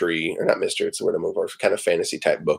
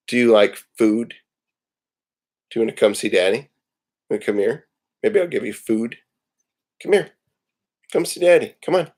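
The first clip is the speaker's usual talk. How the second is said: coaxing the dog; 2 semitones higher than usual. Real, not acted. Dad coaxes his dog to come for food. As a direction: call the puppy over sweetly.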